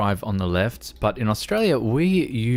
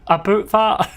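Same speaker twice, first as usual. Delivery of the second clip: put-on clumsy British accent, higher and louder; British accent attempt; 11 semitones higher, 6 dB louder than usual. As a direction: try a bad British accent and laugh through it. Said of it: "It just sounds like Little Britain".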